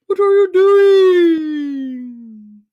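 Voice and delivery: ordinary delivery, nothing marked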